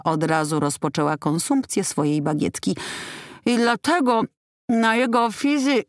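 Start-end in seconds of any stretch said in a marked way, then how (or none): 3.5-5.8 talking through a mouthful of baguette; muffled words with food in the mouth; mouth full. Acted. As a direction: mumble around a big bite. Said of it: "Może przełknij".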